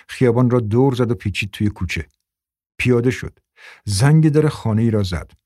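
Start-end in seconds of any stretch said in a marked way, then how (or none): none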